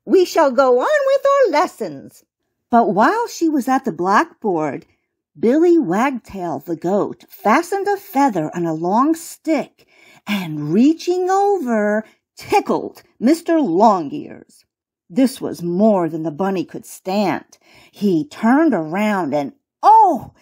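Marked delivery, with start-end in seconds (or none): 0.0-2.2 high mouse-teacher voice; prim, high little schoolmistress mouse; squeaky Lady Mouse. A narrator's reading. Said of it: "squeaked the Lady Mouse". 19.8-20.3 high, loud storyteller's exclamation; sudden raised exclamation; exclaimed oh. A narrator's reading. Exclaims at the bunny's look.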